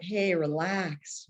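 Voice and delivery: slow, drawn-out hey relax; slow patronizing relax; slow calming. Retold or imitated. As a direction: stretch it out like someone placating. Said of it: "someone's like".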